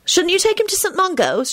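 put-on high-pitched girl's demand. A narrator's reading. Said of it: "she demanded".

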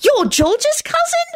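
put-on very high, stretched boyish delight; delighted outburst. A narrator's reading. Timothy learns the boy is George's cousin, delighted. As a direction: burst out in squeaky, slow amazement. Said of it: "Timothy burst out delightedly".

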